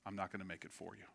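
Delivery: soft, low, gentle voicing of God; hushed, courteous, imagined divine reply; perfect gentleman. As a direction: leave the choice to them, very quietly. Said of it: "like a perfect gentleman".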